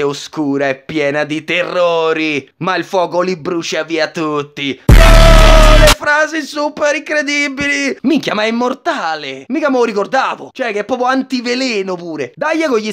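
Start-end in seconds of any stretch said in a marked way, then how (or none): 4.9-8.0 high, loud awestruck gasp; hammed-up wonder; mock amazement. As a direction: gush over the line in fake awe. Mocking awe at the phrase.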